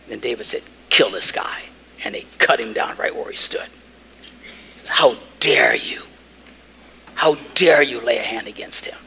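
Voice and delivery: hushed, breathy whisper throughout; whispered retelling. A narrator's reading. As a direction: drop to a whisper for the story's grim turn. Hushed retelling of David's order.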